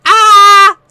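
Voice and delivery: loud, high mock scream; mock outcry. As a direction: imitate a pained listener crying out. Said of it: "I'm sorry to anyone who's like".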